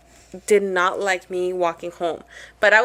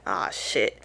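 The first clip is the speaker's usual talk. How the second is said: low, soft swear of dread; acted quiet "uh-oh" moment; sinking dread; 6 semitones lower, 8 dB quieter than usual. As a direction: drop right down as it dawns on you. Acted dread on noticing van following.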